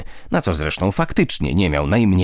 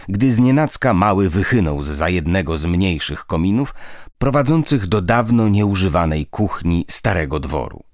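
ordinary delivery, nothing marked

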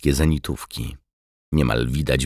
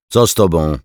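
raised, urgent question; calling by radio; 5 semitones higher, 8 dB louder than usual. A narrator's reading. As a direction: call out to the silent plane, higher and louder. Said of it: "próbował wywoływać Bagiński". (głośne, naglące pytanie przez radio)

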